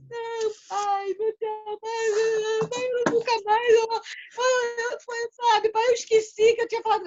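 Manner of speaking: put-on high, wailing child's sobbing plea; crying child. Caught son crying to his father, high and pleading. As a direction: beg through tears like a scared little boy. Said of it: "ele já desceu já chorando".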